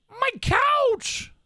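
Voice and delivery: high, loud mock cry; mock outrage. Owner mock-wails at the shredded couch in the photo. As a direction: wail over the ruined couch, hammed-up.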